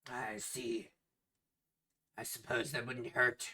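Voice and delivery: hushed, whispery captain voice. Acted. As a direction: let it out as a near whisper. Captain mulls over the offer.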